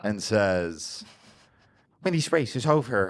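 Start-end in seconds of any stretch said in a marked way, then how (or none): 2.0-3.1 lightly voiced leering villain; mildly lecherous villain. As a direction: proposition them with a touch of a leer. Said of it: "He leerily looks you up and down".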